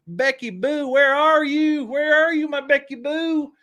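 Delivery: high, slow sing-song call; mock cooing call. Attorney General calling for Becky, high mock cooing. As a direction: coo for her like a lost pet. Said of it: "he was like".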